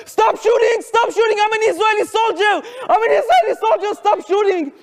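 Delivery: desperate high cry to stop the shooting; terrified shouting. Retold or imitated. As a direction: scream it in terror at the soldiers. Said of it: "I was so afraid that the only thing I can think about saying".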